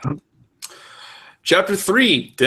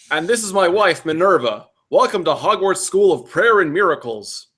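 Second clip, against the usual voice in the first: lightly voiced preacher, pitched up; amicable reverend; 7 semitones higher, 4 dB louder than usual. Reverend introducing himself to a new pupil, amicable. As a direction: introduce yourself grandly as the reverend.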